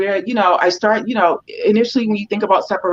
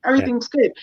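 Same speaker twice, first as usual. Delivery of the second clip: higher, lightly acted relief; voicing the relieved dog; 6 semitones higher, faster than usual. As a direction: settle into mock relief as the dog. Voices the reassured dog's thought.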